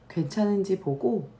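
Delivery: ordinary talk, nothing marked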